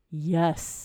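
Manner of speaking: low, slow ghost voice saying yes; a lightly spooky ghost reply; deep ghostly voice. Retold or imitated. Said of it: "In a deep, ghostly voice".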